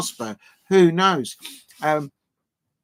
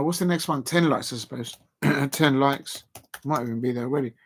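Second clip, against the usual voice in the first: ordinary talk, nothing marked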